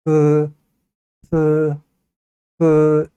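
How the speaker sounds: 스 said with the tongue flattened, held long; langue à plat. Shown as a demonstration. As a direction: demonstrate a drawn-out 스, tongue laid flat. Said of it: "혀를 펴고 발음하면 돼요".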